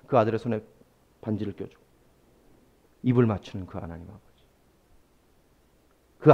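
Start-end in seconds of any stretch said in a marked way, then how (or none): 0.1-4.4 tender hush. Acted. Tender image of the father.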